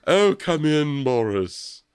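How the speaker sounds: lightly put-on posh British greeting; fake British accent. Friend imitates the mother's fake British accent to Boris. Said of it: "fake accent that she's like".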